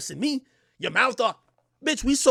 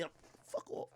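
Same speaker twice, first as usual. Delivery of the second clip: hissed under breath; 20 dB quieter than usual. Retold or imitated. Shoving her off quietly.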